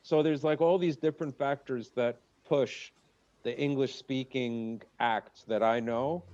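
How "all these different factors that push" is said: ordinary talk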